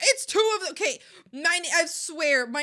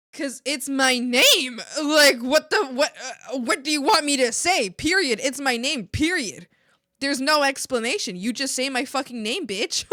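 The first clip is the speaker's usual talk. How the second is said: angry retort; 3 semitones higher, 7 dB louder than usual. Retold or imitated. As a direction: spell it out furiously, every word a full stop. Retold angry reply to manager.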